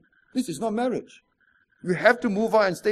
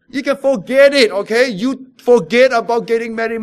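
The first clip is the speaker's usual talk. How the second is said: louder, hammering the point home; emphatic loud warning; 3 semitones higher, 9 dB louder than usual. Real, not acted. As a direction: warn the congregation firmly. Preacher raises voice sharply.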